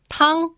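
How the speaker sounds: "tang" held high and level; first tone. Shown as a demonstration. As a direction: say "tang" on a high, even pitch. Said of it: "The first tone".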